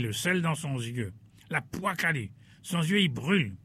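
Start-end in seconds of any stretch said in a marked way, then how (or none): none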